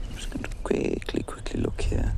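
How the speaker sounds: hushed whisper while peering closer. Real, not acted. Guide to viewers, whispering while looking closely. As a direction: whisper so as not to disturb the snake.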